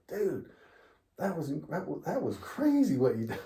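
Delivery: high, awed teammate voice; wide-eyed teammate gushing; teammates amazed. Retold or imitated. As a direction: teammate in awe. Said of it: "they're like".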